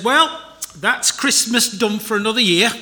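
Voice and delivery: a hint of a Yorkshire grandma, lightly put on; a light, self-mocking Yorkshire accent. Preacher voicing Grandma after Christmas presents in a mild Yorkshire accent. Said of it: "I can't do a Yorkshire accent very well".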